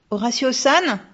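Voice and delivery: put-on girl calling loudly into the dark. A narrator's reading. Girl calls out to the ghost in the dark, loud and high. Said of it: "s'écria-t-elle".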